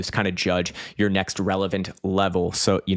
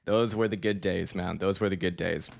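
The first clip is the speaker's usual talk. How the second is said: softer, settling after a laugh; wistful reminiscing; 7 dB quieter than usual. Real, not acted. Streamer reminiscing about teenage gaming days, soft and wistful. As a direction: look back fondly, quietly.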